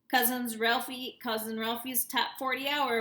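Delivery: loud, slowed radio host voice. A narrator's reading. Radio DJ greeting a caller on air, booming. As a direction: boom it out like a DJ.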